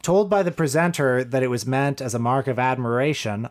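normal talking voice

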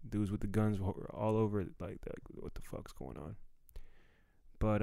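normal talking voice

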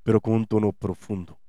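a lightly done calm, deep DJ tone; late-night DJ calm. Shown as a demonstration. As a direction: ease down like a night radio host. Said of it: "Chris utiliza esta voz como la voz del D J de la radio".

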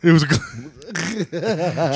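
breaks into a laugh mid-word; slow, laughing through the word. Real, not acted. Laughing while trying to say it to his friend.

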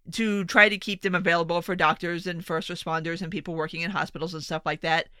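ordinary talk, nothing marked

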